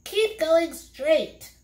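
high, slow, eerie voice; a sly guiding voice, high and drawn out; counseling voice. A narrator's reading. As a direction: lead them on, slow and high. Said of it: "counseled the voice".

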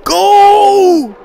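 very high, loud shout. Real, not acted. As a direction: yell it at full force. Cheering on the jump.

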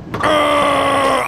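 very high, drawn-out "arrgh"; frustrated howl. Real, not acted. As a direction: wail in high-pitched frustration. Throw goes wrong again.

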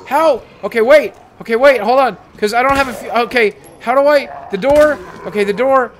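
loud, high, broken-off phrases; flustered panic. Real, not acted. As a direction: scramble for a plan under attack. Attacked, hunting for the door.

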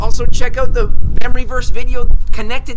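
bright, loud promo read for families; upbeat channel sign-off; host outro. Real, not acted. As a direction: wrap up the episode like a church YouTube host.

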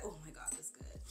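hushed, low whisper of pleasure; whispered delight. Real, not acted. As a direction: whisper it, overcome by the scent. Blissful aside on smelling the cream.

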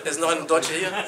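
loud, laughing call to the room. Real, not acted. Calls out to crowd, laughing.

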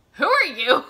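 high, very loud startled question; hammed-up disbelief at a stranger; incredulous. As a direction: demand it in comic shock. Heroine answers stranger's proposal.

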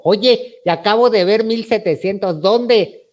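excited retold phone call. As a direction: burst out with the good news. Excitedly calls friend about money.

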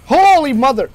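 loud startled exclamation; shocked shout. Real, not acted. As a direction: blurt it in shock.

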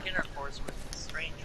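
whisper. Real, not acted. Joking about rapey ghost.

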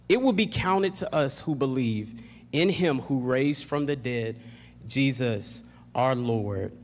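ordinary delivery

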